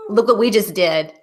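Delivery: ordinary talk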